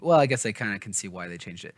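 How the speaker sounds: ordinary delivery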